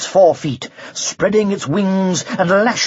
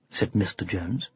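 ordinary delivery, nothing marked

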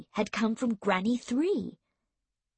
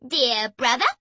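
unfriendly sister; 2 semitones higher, 4 dB louder than usual. A narrator's reading. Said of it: "gave Erg an unfriendly look".

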